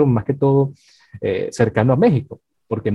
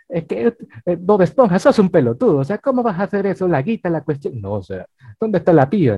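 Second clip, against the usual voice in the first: higher-pitched mock Argentine cartoon voice; Argentine accent; 6 semitones higher than usual. Host imagining SpongeBob dubbed in Argentina, mock Argentine accent. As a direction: play it as an exasperated Argentine, animated and high. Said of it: "pero un poquito más a-, eh, argentino".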